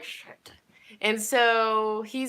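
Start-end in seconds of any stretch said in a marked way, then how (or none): 0.0-0.5 breathy aside nobody should hear; whispered curse. Retold or imitated. Girl spotting her father at the store, whispered curse. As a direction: whisper it under your breath. Seeing her dad behind mom.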